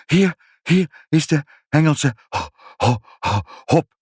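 high, panting, broken speech; out of breath. A narrator's reading. Mother rushes in with the hops, out of breath. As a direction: gasp it out, catching on the last word. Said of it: "zei ze buiten adem".

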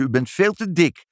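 put-on high-pitched blunt little girl; cheeky child. A narrator's reading. Child to the lady visitor, blunt. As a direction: blurt it out like a tactless child.

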